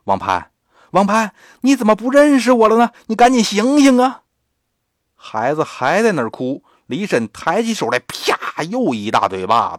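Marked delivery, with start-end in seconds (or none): 0.0-4.2 越喊越高越响，语速加快; 提高嗓门连声催促; 急切大喊. Acted. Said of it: "接着喊". (李婶冲着哭闹的孩子高声急喊)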